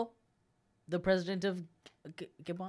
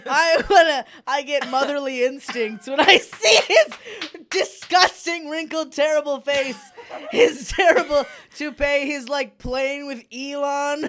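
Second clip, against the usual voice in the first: mock motherly gushing; 7 semitones higher, 10 dB louder, slower than usual.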